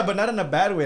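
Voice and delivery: usual speaking voice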